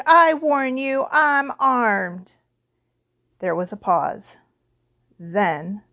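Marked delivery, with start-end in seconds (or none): 0.1-2.3 slow, loud, higher-pitched warning; shouting. A narrator's reading. Uncle with a rifle warning the intruder, shouting. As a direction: shout it as a drawn-out threat. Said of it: "he shouted".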